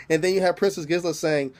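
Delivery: ordinary delivery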